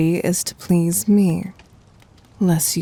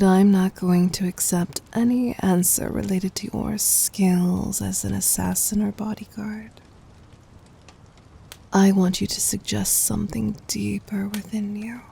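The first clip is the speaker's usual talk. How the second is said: whisper. Acted. Tender intimate command.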